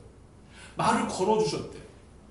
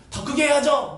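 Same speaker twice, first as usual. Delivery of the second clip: loud, high, riled retort; mock defiance; 5 semitones higher, 5 dB louder than usual. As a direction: push back, insisting on more volume. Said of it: "바디메오가 더 화났어". (발끈해서 큰 소리로)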